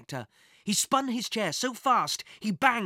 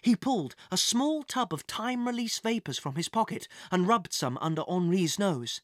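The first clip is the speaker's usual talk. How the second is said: usual speaking voice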